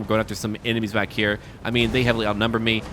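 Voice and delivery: normal talking voice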